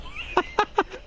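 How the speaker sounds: high, wheezy laugh; real laugh. Real, not acted. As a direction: burst into high, voiceless laughter. Laughing at the Hoover mix-up.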